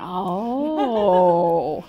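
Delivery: loud startled oh; surprised gasp. Retold or imitated. Retelling her shock when the apron vanished, loud. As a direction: act out finding it gone.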